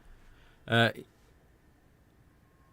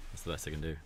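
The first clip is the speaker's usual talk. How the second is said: normal talking voice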